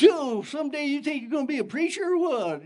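put-on high, taunting teen voice; mocking kid. Mouthy kid taunting the young preacher, mocking. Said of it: "He just goes".

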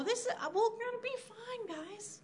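high, soft, complacent voice; mock reassurance. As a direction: soothe them falsely, high and small.

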